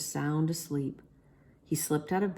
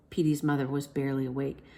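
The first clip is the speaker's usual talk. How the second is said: usual speaking voice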